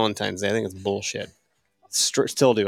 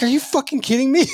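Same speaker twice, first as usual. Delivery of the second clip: put-on incredulous girlfriend; 13 semitones higher than usual. Girlfriend reacting to a couch proposal, high mock disbelief. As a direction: play the shocked girlfriend, pitched way up.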